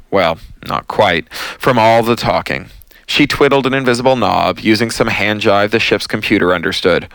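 ordinary delivery

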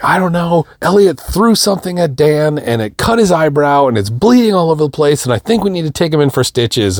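high-pitched, run-on panicked report; freaking out. Retold or imitated. Wife tells husband about the injured son, panicked. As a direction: imitate a frantic wife spilling the news. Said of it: "Steph is freaking out".

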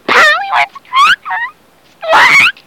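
very high squawking parrot. Acted. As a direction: screech it like a parrot. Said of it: "all he says is".

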